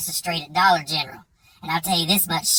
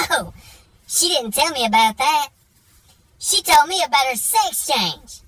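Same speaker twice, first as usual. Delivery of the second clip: Jake's voice; 8 semitones higher, 3 dB louder than usual. Retold or imitated. Jake revealing the secret, high mock voice. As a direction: pitch up and drag out the punchline.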